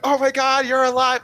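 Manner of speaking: high, loud cry of amazed joy; excited yell. Acted. Player as character running to Jojen, excited yell. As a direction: yell it as if greeting the lost friend. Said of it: "like, yell his name".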